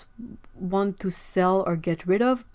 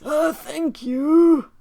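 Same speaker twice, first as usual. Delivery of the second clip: put-on very old man; 7 semitones higher, 7 dB louder, much slower than usual. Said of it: "I love your old man voice".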